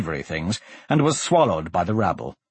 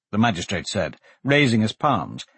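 plain reading voice

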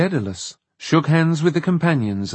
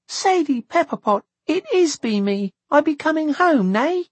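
put-on high-pitched joyful child. A narrator's reading. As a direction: greet a friend excitedly as a little girl. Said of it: "Laura Glue exclaimed joyfully".

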